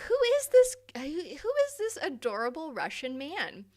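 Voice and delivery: high, loud fangirl squeal; hammed-up swooning excitement; smitten gushing. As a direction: gush over a new crush at the top of your voice. Acts out her smitten first reaction.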